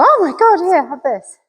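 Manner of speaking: high, thrilled burst of praise then easy offer; delighted praise. Shown as a demonstration. Trainer shows owners how to praise a dog that looks at them, thrilled. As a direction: gush over the dog like it did something amazing. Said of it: "you go".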